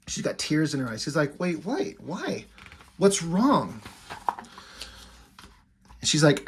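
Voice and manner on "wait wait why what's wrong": acted-out worried questions, higher and rising; bewildered concern. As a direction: play a confused boyfriend, voice lifting on each question. Hero reacts to girlfriend's tears.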